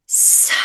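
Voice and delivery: "suck" drawn out on a long exhale; exhaled mantra. Shown as a demonstration. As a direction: stretch "suck" out with the breath, then close on the k. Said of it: "Then on your exhale".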